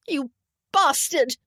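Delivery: high, loud, tearful-angry insult; furious outburst. A narrator's reading. Sobbing woman turns on Damien, furious. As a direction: hurl it at him in a fury.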